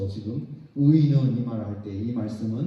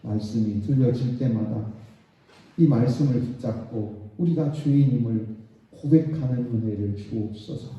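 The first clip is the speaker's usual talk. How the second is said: loud, slow fervent prayer; 3 semitones lower, 6 dB louder than usual. Real, not acted. Pastor praying aloud for the congregation, loud and slow. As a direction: pray it out slowly, earnestly.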